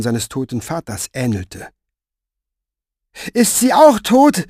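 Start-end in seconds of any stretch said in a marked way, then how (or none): 3.3-4.5 put-on high, loud child's worried question; anxious boy. A narrator's reading. Jacopo asking if she is dead, high child's voice. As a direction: ask like a scared little boy.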